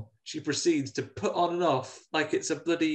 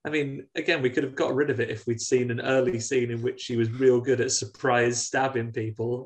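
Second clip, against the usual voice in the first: ordinary talk, nothing marked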